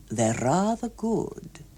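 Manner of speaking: slow, lightly voiced older man; a hint of a prim gentleman. A narrator's reading. Hughes Evans offers chocolate.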